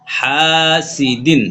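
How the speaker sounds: হাসিদিন with হা drawn out long; slow recited হাসিদিন; elongated madd. Shown as a demonstration. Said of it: "হা আলিফ জবর হা".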